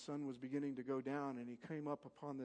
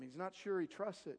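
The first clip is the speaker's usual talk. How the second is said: normal talking voice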